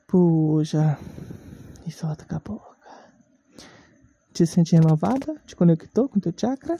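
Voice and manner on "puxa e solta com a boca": slow yoga breathing instructions; breathing guide, ending in a whisper; guided breathing. Shown as a demonstration. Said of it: "vamos respirar fundo agora".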